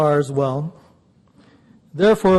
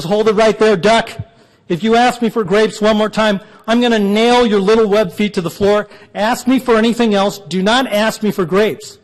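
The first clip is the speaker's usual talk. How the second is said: raised, higher mock-angry voice; angry manager; 3 semitones higher, 4 dB louder than usual. Store manager threatens a pestering duck over grapes, mock anger. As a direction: lay down a hammed-up threat, hitting the words hard.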